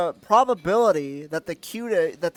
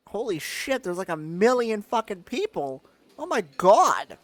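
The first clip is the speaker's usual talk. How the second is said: ordinary talk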